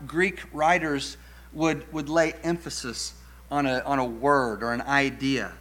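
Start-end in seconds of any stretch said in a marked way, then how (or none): none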